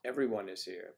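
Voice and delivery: ordinary delivery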